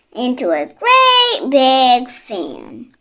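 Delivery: ordinary delivery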